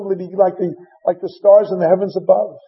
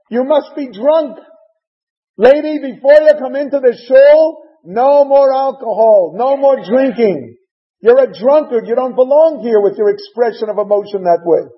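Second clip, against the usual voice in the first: louder, higher scolding priest; condemning rebuke; 5 semitones higher, 3 dB louder than usual. Acted. Priest Eli scolding Chana for being drunk, stern rebuke. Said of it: "he condemns her and he says".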